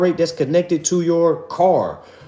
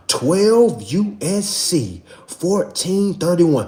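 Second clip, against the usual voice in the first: slow, spaced-out citation again; unhurried spelled-out numbers; slow repeat; much slower than usual. Shown as a demonstration. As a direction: hammer the statute number home slowly. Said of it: "One more time".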